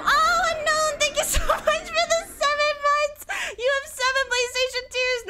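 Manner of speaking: very high, loud gushing squeal; excited thanks. Real, not acted. Thanking a viewer for seven months.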